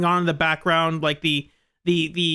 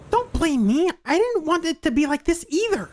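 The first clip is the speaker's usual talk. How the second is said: lightly voiced higher character; defensive higher character voice; 10 semitones higher than usual.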